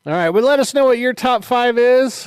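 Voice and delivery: loud bright host announcement; excited host. Real, not acted. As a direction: pump up the audience. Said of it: "podcast host sign-off announcement".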